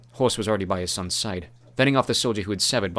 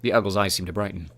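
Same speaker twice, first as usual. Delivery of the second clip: ordinary delivery, nothing marked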